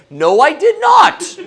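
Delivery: loud, laughing no; laughing admission. Acted. Admitting to his wife, a laughing loud no. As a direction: own up with a laugh. Admits forgetting wife's favor.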